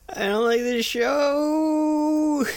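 high, slow mock whine; put-on voice. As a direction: drag it out in a high silly voice. Mock whiny voice, show recap.

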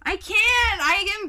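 high, loud embarrassed yelp; flustered shriek; embarrassed squeal. Real, not acted.

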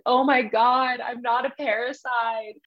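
high-pitched exaggerated wail, dragging out the apology; mock crying. Father pleading after killing his daughter, in mock crying. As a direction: put on a hammed-up tearful plea. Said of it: "he's, like, crying".

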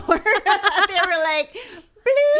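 high, slow laughing bloop; mock lock sound. Mimicking the other driver locking their doors.

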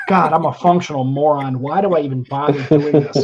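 loud mock despair at himself; exasperated self-scolding. Host hearing his terrible early show, exasperated. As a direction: berate yourself loudly, hammed-up.

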